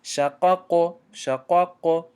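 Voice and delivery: شقق with a full, rounded "qo"; correct heavy qaf. Shown as a demonstration. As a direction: stress the heavy back-of-mouth ق in شقق. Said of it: "نگفتیم".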